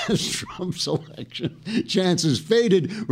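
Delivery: high, slow reading through laughter; laughing. Real, not acted. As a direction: crack up while reading the script. Cracks up reading his satire.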